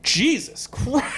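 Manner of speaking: slow, high drawn-out awe; slow amazed Jesus Christ; awed exclamation. Retold or imitated. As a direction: gasp it out, stunned by him. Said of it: "And I go".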